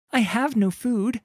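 fearful call. Acted. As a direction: call out, trying to hide her fear. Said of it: "she calls out, hating the fear".

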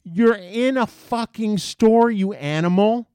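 loud, high-pitched outraged rant; angry scolding. Retold or imitated. Customer yelling at the skateboarding kid, angry scolding.

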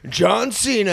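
loud, high hype-man ad read; imitating a booming event promo; wrestling promo announcer. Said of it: "you know the John Cena music?".

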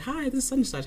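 a hint of a bright phone greeting; cheery phone greeting. Coworker answering the library phone, lightly mock-cheery. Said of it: "she'd be like".

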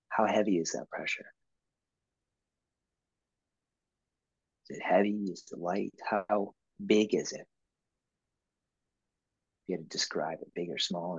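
quiet calm coaching voice; soft guiding questions. Shown as a demonstration. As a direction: ask softly like guiding a client to feel inside. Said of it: "guiding a client inward".

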